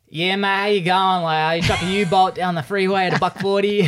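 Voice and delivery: high, broad Australian bloke voice; real Aussie accent. Acted. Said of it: "When you got real Australians, they'll speak like".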